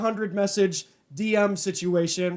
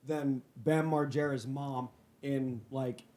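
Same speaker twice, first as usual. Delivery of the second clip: calmed down; 6 semitones lower, 7 dB quieter than usual. Real, not acted. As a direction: lower and much softer, mellow after the break. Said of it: "calm down and relax".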